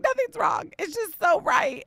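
so right, almost shouting; emotional high exclaim. Retold or imitated. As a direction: gush it out, tearful and happy. Her answer to kids.

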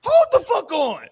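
loud, high-pitched interruption; loud protest. Real, not acted. Host halting the played video, loud protest.

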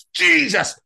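high, loud gasp of shock; shocked yelp. Retold or imitated. Swimmer hitting freezing water, yelping. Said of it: "I got in going".

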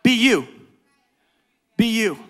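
ordinary delivery

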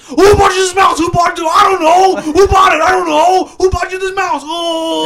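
ranting computer tech, building to shouts; Barry impression. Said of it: "Impersonation of Barry Robb".

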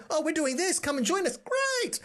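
high, bubbly mock enthusiasm; mock gushing friends.

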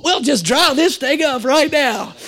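light impression of a confident mom; lightly voiced confident mom. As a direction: sure of herself, a little lifted. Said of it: "mom voiced in story".

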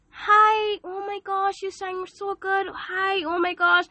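high, gushing sugary voice; cutesy fake friend. Fake friend flattering to win you over, high cutesy gush. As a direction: gush like a fake-sweet fan. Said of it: "talks to you in a cutesy way".

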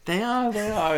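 high, squeaky laughing voice; laughing agreement. Real, not acted. Agreeing they're all the same height, really laughing. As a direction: agree helplessly through a laugh, pitched right up.

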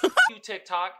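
ordinary talk

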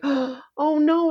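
high-pitched, heartbroken reaction; retold dismay. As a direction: act out dreading a beloved character's fate. Acting out reaction to Steven's fate.

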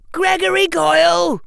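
loud, high-pitched yell of a name; high commanding shout; screamed. A narrator's reading. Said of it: "screamed Harry".

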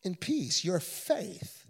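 soft, low, reassuring blessing; tender Jesus. Acted. Jesus blessing the healed woman, soft and tender. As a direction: speak peace over her, fading softly. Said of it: "And he says".

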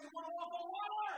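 high, loud rallying question; rousing shout. Real, not acted. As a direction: shout it out to the whole congregation. Preacher rallying crowd to repeat.

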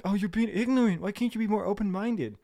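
high-pitched mock reproach; mocking whine. Mimicking people who call him ignorant, mocking and high. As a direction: parody the scolders way up high.

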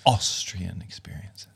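breathy dramatic whisper, slow; whispered title. Acted. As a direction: hush it like a secret. (geheimnisvoll geflüstert)

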